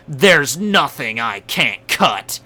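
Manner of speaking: slow, dramatic swordsman line; cool Zoro line. Retold or imitated. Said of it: "he came out and said".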